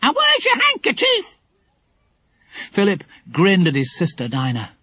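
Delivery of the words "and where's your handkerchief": a parrot scolding like a nanny; stern parrot. Acted. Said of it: "she said sternly".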